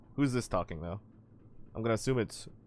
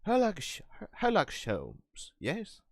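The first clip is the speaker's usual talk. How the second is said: trying out an English detective voice; English accent; 8 semitones higher than usual. Shown as a demonstration. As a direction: test a theatrical English accent, haltingly. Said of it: "Kind of like an English accent".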